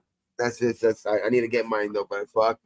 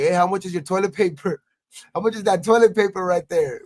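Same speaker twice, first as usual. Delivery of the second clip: calling out; 7 semitones higher, 5 dB louder than usual. Retold or imitated. Calling to street vendors.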